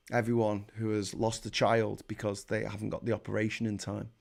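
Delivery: low, hushed, measured seriousness; quiet, disturbed gravity; grave and sombre. Real, not acted. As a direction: drop right down and let it sink in. Grave turn to children who died.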